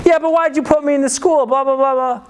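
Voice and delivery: high, whiny complaint; hammed-up kid wailing at a parent; mock angry. Kid home from school blames his mom, whining angrily. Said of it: "I'm kinda angry about it".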